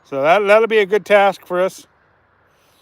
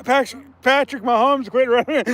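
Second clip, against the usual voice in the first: usual speaking voice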